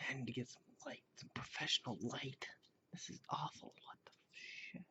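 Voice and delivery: grumbling to himself in a whisper; annoyed whispered muttering at the lighting. Real, not acted. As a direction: whisper the complaints under the breath. Muttering complaints about bad lighting.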